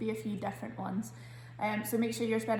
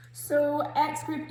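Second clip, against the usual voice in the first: calling the group; 5 semitones higher, 4 dB louder, much slower than usual. Real, not acted. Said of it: "calling on group".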